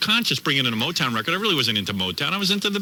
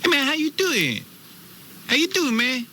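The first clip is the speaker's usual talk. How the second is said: much higher put-on street voice, pleading; mock homeboy begging off a bully; hammed-up urban slang whine; 13 semitones higher than usual.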